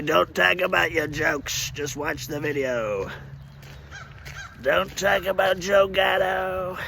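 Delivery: a hint of a slow stern lecture; lightly voiced scolding critics, slow; slow mock scold. Critics scold him about his jokes, slow and lightly stern. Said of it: "the mind jackers say".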